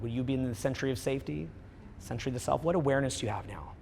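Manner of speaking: low, soft, hushed guiding voice; almost whisper, draw them inward; hushed meditation. Acted.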